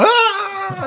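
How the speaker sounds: high startled yelp; sudden scream. Pretend. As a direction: shriek as the croc strikes. Attacked by mother croc.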